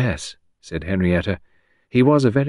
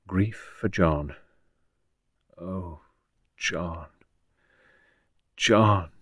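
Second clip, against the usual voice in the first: grieving; 4 dB quieter, much slower than usual. Acted. Woman mourns John alone, softly grieving. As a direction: murmur the name into long pauses. Tearful woman thinks of lost lover.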